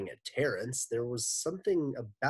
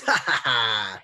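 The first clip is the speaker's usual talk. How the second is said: evil laugh; 2 semitones higher, 9 dB louder than usual. Acted. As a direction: laugh like a gloating villain. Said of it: "he laughed".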